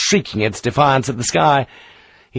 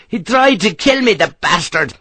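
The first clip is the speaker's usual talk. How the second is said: a high, indignant outburst; furious; 8 semitones higher than usual. Acted. Harper tells Sharp about the attacker, furious. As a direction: rage at the man who attacked him. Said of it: "The Irishman was in a fury".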